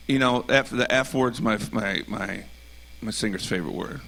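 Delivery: ordinary delivery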